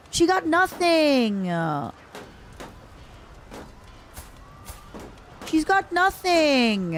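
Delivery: high, drawn-out wail of pity; hammed-up pitying whine; mock lament. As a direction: wail it slowly, sliding down at the end. Lamenting for orphaned game character.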